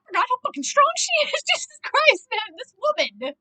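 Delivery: high, loud disbelief; amazed exclamation. Real, not acted. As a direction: exclaim it, floored by her strength. Amazed reaction to character's strength.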